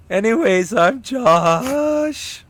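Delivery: high-pitched announcer voice; high put-on voice. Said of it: "that's how you normally sound".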